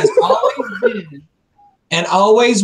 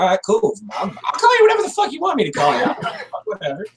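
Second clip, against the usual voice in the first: breezy agreement; 8 semitones higher, faster than usual. Retold or imitated. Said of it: "I'm like".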